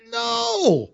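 can't believe what you just heard; incredulous no. Acted. Preacher reacting to the disciples' bread reasoning, mock incredulity.

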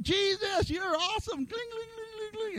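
high, hushed, tearful praise with pan clangs; high soft tearful praise. Retold or imitated. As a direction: sing out softly, moved to tears. Worshipper banging pans crying.

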